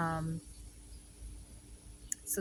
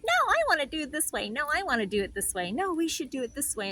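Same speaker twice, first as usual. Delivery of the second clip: higher, fast, a hint of a bickering kid; mock sibling quarrel, quick and insistent; a touch of childish squabbling; 7 semitones higher, faster than usual. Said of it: "siblings that are fighting each other".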